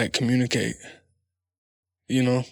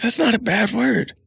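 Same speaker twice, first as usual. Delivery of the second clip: high reassuring dad; 8 semitones higher than usual. Retold or imitated. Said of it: "I said".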